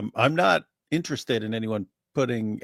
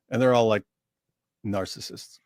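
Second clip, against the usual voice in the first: normal talking voice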